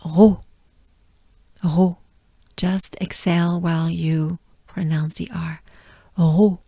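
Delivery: ro with breathy R into round O; French ro. Shown as a demonstration. Teacher drilling the syllable ro. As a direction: exhale the R, round the O. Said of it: "a good breath first".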